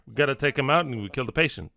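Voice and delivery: ordinary talk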